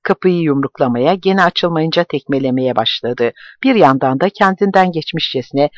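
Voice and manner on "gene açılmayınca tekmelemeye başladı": ordinary delivery, nothing marked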